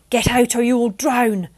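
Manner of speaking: loud commanding shout; angry warning from above the waves. Acted. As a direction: bark the order at her. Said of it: "Alexander shouted".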